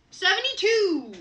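loud, high excited call; thrilled outburst; excited shout. Real, not acted. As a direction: announce it like a win. Excited announcing download count.

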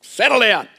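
a mother snapping at her sons to stop; exasperated mum breaking up a fight; mum scolding. Retold or imitated. Mum stopping fighting boys.

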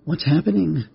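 put-on high, worried boyish whine; whining. A narrator's reading. Boy now concerned for the spider, high worried whine. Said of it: "Rex whined".

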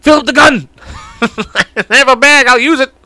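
hammed-up holdup yell; mock robber shout. Acting robbers' gun mixup.

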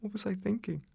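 high, soft question to himself; rueful self-questioning. Acted. Nurse regretting that he did not go, quietly rueful. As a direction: drop soft and high, doubting yourself. Questions his choice to stay.

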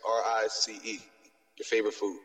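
ordinary talk

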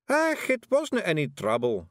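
high, slow Scots breeziness; cheerful Scottish Feegle. A narrator's reading. Rob answering Miss Level's thanks, cheerful. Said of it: "said Rob Anybody cheerfully".

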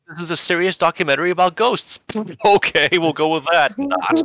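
high laughing sarcastic jab; mock serious. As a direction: deliver it as an over-the-top joke. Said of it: "joking sarcasm".

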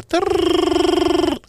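long high rolled trill; vocal warm-up trill. Shown as a demonstration. The host's vocal warm-up, a high rolled trill. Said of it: "aquecimento vocal".

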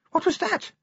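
very high, jumpy clerk voice; a nervous little man jumping at a noise; startled clerk. Acted. The clerk hears a thud from the plaza, startled and high. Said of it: "A E Pessimal said".

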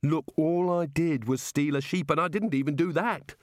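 understated desperate complaint. A narrator's reading. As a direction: plead innocence with a touch of desperation. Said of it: "said Rincewind desperately".

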